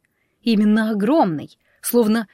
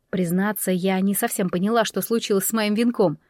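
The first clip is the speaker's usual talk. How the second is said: plain reading voice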